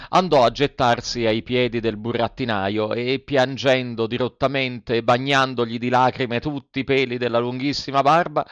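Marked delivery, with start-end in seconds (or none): none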